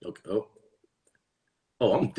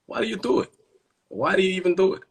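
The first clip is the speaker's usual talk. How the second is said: high-pitched mock exasperation; exasperated pleading; 10 semitones higher, much faster than usual. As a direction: whine the question up high in disbelief.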